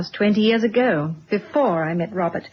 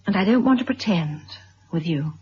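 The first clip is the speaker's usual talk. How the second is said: ordinary talk, nothing marked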